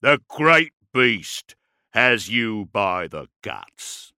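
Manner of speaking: slow, loud guttural growl of an orc warlord; big slow brute pronouncing a threat. A narrator's reading. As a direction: speak it slowly and loudly like a guttural orc. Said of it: "His Gothic was guttural".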